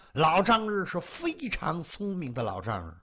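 usual speaking voice

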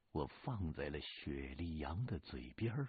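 ordinary delivery, nothing marked

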